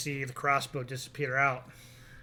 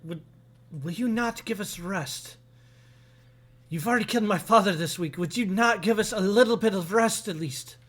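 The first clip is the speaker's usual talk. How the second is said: higher, bitter plea from a grieving daughter; pleading woman; 6 semitones higher, 5 dB louder than usual. Acted. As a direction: beg them for peace, worn out and raw. Weary woman pleads with strangers.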